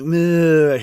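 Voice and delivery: loud high zombie moan; zombie groan. Retold or imitated. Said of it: "he's like".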